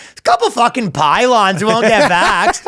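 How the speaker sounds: hammed-up Canadian translator; Canadian accent. Canadian translator rewording remarks on vaccine refusers, Canadian accent. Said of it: "They have a Canadian translator".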